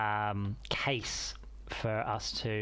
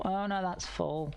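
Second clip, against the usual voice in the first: slow, high dismayed oh no; drawn-out surprised groan; 8 semitones higher than usual. Real, not acted. Sees the storage is full.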